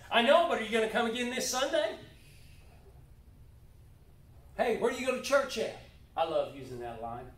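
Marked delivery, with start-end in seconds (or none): 0.1-5.8 eager invite. Shown as a demonstration. Acted invitation to church.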